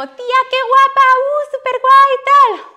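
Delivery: gushing fan. Retold or imitated. Followers gushing over her photo, high squealing.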